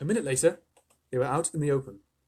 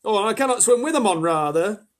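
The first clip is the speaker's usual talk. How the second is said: higher, louder muddled centipede; flustered centipede; 8 semitones higher, 9 dB louder than usual. A narrator's reading. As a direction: blurt it then correct himself. Centipede panicking.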